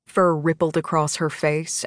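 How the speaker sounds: ordinary delivery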